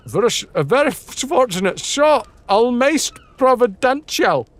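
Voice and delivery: half-hearted Scottish guide voice; attempted Scottish accent. Streamer voicing the Scottish guide, rough Scottish accent. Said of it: "I forgot, is he Scottish?".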